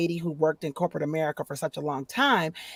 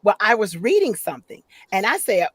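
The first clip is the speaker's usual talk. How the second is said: normal talking voice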